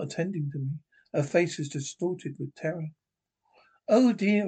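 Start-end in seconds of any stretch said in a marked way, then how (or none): 3.9-4.5 first frightened cry, raised and loud; panicked housekeeper, louder first cry; terrified oh dear. A narrator's reading. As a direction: gasp it out in terror. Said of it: "she went".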